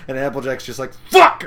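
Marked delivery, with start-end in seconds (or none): 1.1-1.4 loud, high outburst of swearing; mock angry yell. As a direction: explode in mock outrage. Applejack swears at the pranksters.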